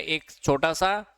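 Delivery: ordinary talk, nothing marked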